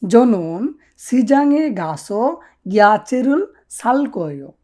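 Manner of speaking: "জনন সিজাংয়ে গাসো গিয়াচেরুল সালকোয়ো" said slowly, syllable by syllable; slow Korean model. Shown as a demonstration. Teacher slowly models the Korean sentence for learners. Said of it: "এইভাবে বলতে হবে।".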